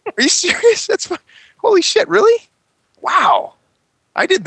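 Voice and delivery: put-on very high, loud, astonished kid; amazed kid. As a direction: gasp it out like a thrilled child. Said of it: "I'm like".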